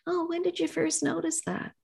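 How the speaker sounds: soft, high, tender question; gentle inquiry. Acted. Therapist following up on a client's soreness, gently. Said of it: "very gently just saying".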